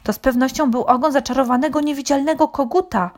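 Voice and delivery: high, quick rooster voice; exclaiming rooster. A narrator's reading. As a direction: rattle it off with conviction. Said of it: "wykrzyknął kogut".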